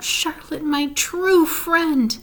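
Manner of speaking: slow, high, heartbroken plea; grieving moan. A narrator's reading. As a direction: stretch it out in sorrow. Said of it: "he moaned".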